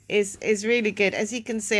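ordinary talk, nothing marked

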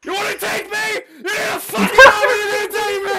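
triumphant shouting. Real, not acted. Boasting after solo kill.

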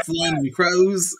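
high-pitched, hammed-up Australian accent. Shown as a demonstration. Guest showing off his accent on the host's request, exaggerated Australian. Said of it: "give us your best, uh, Australian accent".

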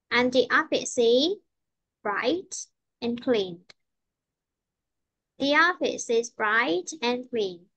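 very slow, "bright" held, "clean" falling; teacher's slow demo with "clean" going down; falling ending. Shown as a demonstration. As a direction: space the words out and drop "clean" to finish. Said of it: "cuối câu đi xuống".

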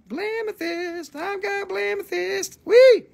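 very high, playful falsetto squeal. Pretend. Seller showing off his Blamethyst, giddy falsetto.

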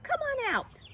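very high coaxing call; high-pitched caterpillar summons; Cornelius. A narrator's reading. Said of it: "he said".

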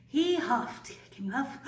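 plain reading voice

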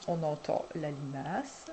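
usual speaking voice

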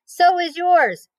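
high, slow teasing comeback; boy teasing his sister from the swing; cheeky boy. A narrator's reading. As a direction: toss it back at her. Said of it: "cried Avery".

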